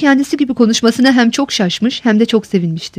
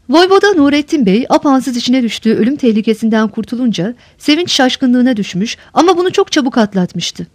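usual speaking voice

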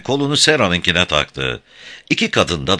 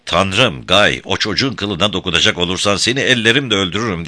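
ordinary delivery

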